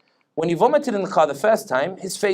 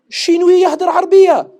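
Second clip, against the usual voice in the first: high, loud amazed exclamation; astonished shopkeeper; 14 semitones higher, 9 dB louder than usual. Retold or imitated. Shopkeeper amazed that a Chinese man speaks Arabic. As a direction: gasp it in disbelief. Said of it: "the shopkeeper ... went".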